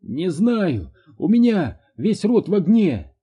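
high, loud panicked cry. A narrator's reading. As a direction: blurt it out in alarm. Mother in burning pain.

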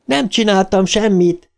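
put-on high-pitched girl crying out in denial; mild cry of protest. A narrator's reading. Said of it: "kiáltotta Beverly". (magas hangú, visszafogott kiáltás)

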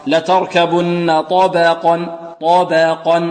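slow recitation with a thin "ba" in طبقا; ترقيق الباء. Shown as a demonstration. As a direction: lighten the ب of طبقا between ط and ق. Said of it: "وبينهما الباء مرققة". (تلاوة بطيئة بباء مرققة في طبقا)

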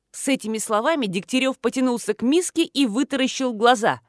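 ordinary delivery, nothing marked

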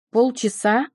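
a touch of rising outrage. Acted. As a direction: snap the word up, lightly. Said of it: "Его голос резко повысился".

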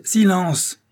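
furious shout. Acted. Father cuts her off.

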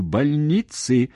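"больницы" drawn out slowly and pitched high; high, slow, pointed stress on "больницы"; emphatic stress. Acted. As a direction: underline "больницы", stretching it out. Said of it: "я подчёркиваю".

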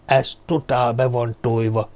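usual speaking voice

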